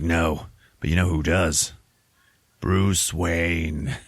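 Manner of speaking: slow, deliberate mock-hero delivery; a hint of solemn superhero, drawing out the name; lightly voiced Batman. Batman answering Robin in the next cell, slow and mock solemn.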